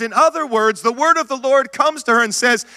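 usual speaking voice

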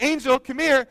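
high-pitched coaxing call; calling a dog. Retold or imitated. Owner calling his frozen poodle, high retold call. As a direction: call the pet over, pitched right up.

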